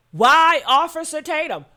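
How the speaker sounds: emphatic repeat. Real, not acted. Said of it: "what I cannot understand is why Officer Tatum?".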